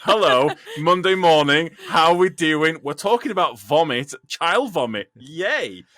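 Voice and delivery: loud, punchy morning-show greeting; mock cheery host. Host restarting the podcast on a grim topic, loud sarcastic cheer. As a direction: belt it out as a mock-upbeat presenter.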